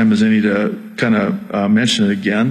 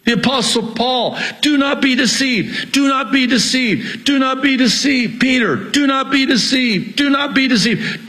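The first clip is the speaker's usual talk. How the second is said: very high, urgent pulpit cry; impassioned high-pitched preaching yell; real preacher's yelling; 12 semitones higher than usual. Real, not acted. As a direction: hammer the warning out at high pitch. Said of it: "I'm sorry, I'm yelling".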